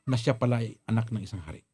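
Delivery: normal talking voice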